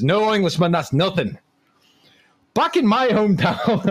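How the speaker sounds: bad Scottish accent. Comic telling a pub joke as the Scotsman, poor Scottish accent. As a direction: put on a clumsy Scottish accent. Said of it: "I can't do a Scottish accent".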